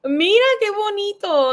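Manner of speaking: ordinary delivery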